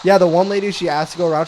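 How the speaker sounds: ordinary delivery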